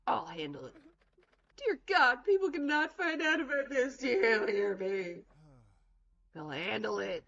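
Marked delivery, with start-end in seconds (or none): 1.6-5.2 loud, higher, alarmed plea; panicked exclamation. Acted. Character begging that nobody finds out, loudly. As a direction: cry out, much higher and louder.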